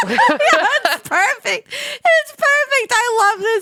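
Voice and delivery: high, slow squeal through laughter; delighted laughing. Real, not acted. Host loving the impression, laughing squeal.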